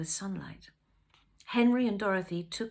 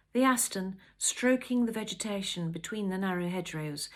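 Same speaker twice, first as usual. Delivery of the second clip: usual speaking voice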